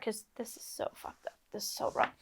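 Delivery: soft, voiceless aside; whispered self-criticism. Real, not acted. Storyteller fumbling the explanation, whispered aside. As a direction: whisper it under your breath.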